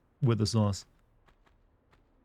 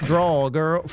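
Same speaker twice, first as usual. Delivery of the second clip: louder, hammed-up Southern drawl; mock southern accent; 3 semitones higher, 4 dB louder than usual. Gamer imitating a Texan game villain, mock Southern accent. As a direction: fake Southern gunslinger line. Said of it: "trying to put on a southern accent".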